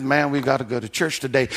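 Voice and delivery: mock the weary churchgoer dragging along; a half-hearted sigh of a grown-up who has to go; reluctant grumble. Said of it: "not screaming it out like some of us adults".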